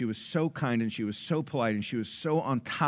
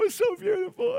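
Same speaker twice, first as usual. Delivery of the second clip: high, mock tearful squeal; mock weeping; 4 dB louder than usual. Family crying at the table over the song, mock tearful high voice. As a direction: overcome with emotion, hammed-up. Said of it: "we're crying at the table".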